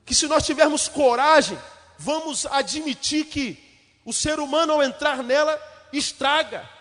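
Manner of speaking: fiery preacher hammering each line; preaching at full force. Acted. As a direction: drive the questions home from the pulpit.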